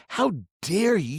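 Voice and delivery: second How dare you climbs in pitch and volume; rising indignation. Retold or imitated. Second take louder, higher.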